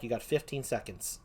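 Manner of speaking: ordinary talk, nothing marked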